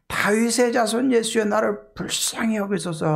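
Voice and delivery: high, pleading call for mercy; crying out. Acted. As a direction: call out to someone passing by. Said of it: "하고 소리를 지르게 돼요".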